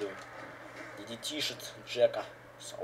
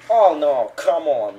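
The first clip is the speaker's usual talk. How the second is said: loud, higher dismayed shout; excited shout; 4 semitones higher, 16 dB louder, much slower than usual. Real, not acted. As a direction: yell in frustration at the screen. Reacts to a bad move. (громкий возмущённый возглас)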